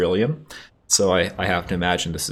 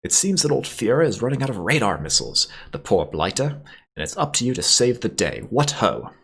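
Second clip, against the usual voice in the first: high, shrill English toff; hammed-up old English officer, rousing; Calvert Smythe; 6 semitones higher than usual. A narrator's reading. Officer sending the pilot to save the day, shrill. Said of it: "he shrills offensively".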